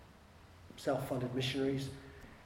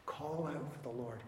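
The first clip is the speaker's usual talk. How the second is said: ordinary talk, nothing marked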